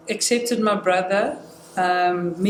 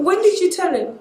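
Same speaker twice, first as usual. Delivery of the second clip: high incredulous question out of a laugh; retold disbelief; 10 semitones higher, 3 dB louder than usual. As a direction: re-enact astonishment, pitch jumping up. Retold shocked question after laughing.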